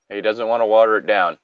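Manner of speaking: plain reading voice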